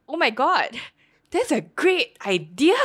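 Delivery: high, loud, slow "great idea" gush; mock excitement. Jerome hears the bad plan, mock excited gush. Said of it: "Jerome ... was like".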